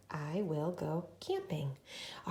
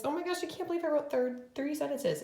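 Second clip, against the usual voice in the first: high mock amazement; surprised; 9 semitones higher than usual. Real, not acted. Teacher catching herself, playfully amazed. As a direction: gasp at your own mistake.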